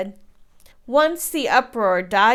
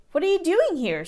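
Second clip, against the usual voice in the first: higher, startled question; startled cry; 8 semitones higher, faster than usual. A narrator's reading. Giant woman startled to find a boy. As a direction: catch her off guard. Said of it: "she cried".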